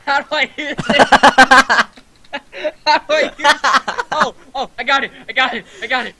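very high, laughing mock panic; panicked squeal. Player scrambling to put out a fire, panicked squeal. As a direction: squeal it in a playful frenzy.

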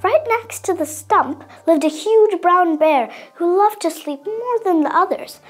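ordinary delivery

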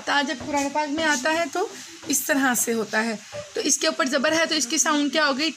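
normal talking voice

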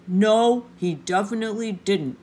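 talking through a package; mouth full. Acted. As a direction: growl with a mouthful. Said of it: "had his mouth full with the package".

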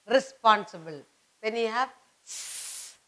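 ordinary delivery

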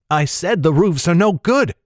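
a hint of exasperated protest, high; protesting young guide. A narrator's reading. Guide objecting to roofs, lightly protesting voice.

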